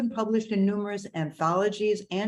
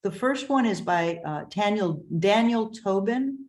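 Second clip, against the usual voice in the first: usual speaking voice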